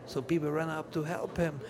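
normal talking voice

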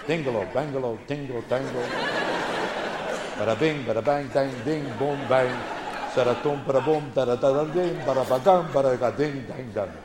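rhythmic sing-song nonsense riff on Italian names; mock Italian names. Comic riffing on Italian kids' names, mock Italian babble. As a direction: rattle off bouncing mock-Italian syllables.

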